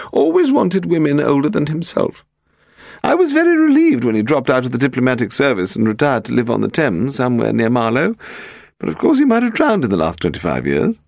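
put-on very high, shrill old lady voice. A narrator's reading. Woman on the telephone to Paul, shrilling high. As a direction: pitch it way up and shrill. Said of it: "she shrilled down the telephone".

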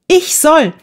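high, bubbling excitement; beaming with joy. A narrator's reading. Eva starts to tell Betty her news, high and overjoyed. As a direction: burst out high, bursting to tell. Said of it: "rief Eva freudenstrahlend".

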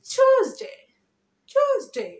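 TUESDAY as "CHOOZ-day", high and loud; smart pronunciation. Shown as a demonstration. Teacher giving learners the smart answer, high punched "chooz-day" pronunciation. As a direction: punch টিউজডে high with a "ch" start, then fall. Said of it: "smartly উচ্চারণ করতে চাই".

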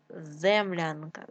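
ordinary delivery

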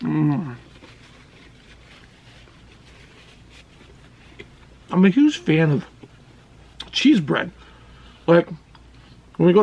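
talking around food; chewing while talking. Real, not acted. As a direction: mouth partly full. Seen 1: Man bit pizza earlier; cheeks bulge, lips pressed, chewing while talking.

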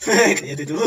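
repeating it through laughter; laughing. Real, not acted. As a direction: giggle the words out. Laughing repeat of friend's line.

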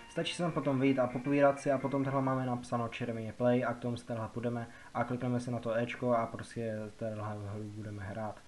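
usual speaking voice

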